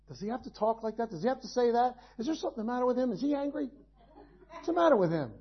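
soft, muttered complaints about the preacher; grumbling listener. Retold or imitated. Churchgoer grumbling about the preacher's tone, softly muttered. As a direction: mutter it like an offended pew-sitter.

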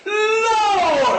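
high, wailing mock-holy "Lord"; showy pious prayer. Preacher mocking people who perform religious words. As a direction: ham up a crying prayer voice. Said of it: "they do it well".

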